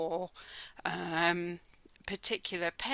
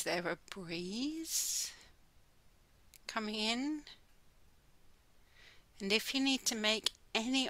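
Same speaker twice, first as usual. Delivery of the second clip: slow, soft meditation voice with long pauses; guided meditation; 4 dB quieter than usual. Real, not acted. Teacher guiding listeners to close their eyes and listen, slow meditation voice. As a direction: ease them gently inward, unhurried, drawing out the words.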